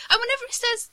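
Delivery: normal talking voice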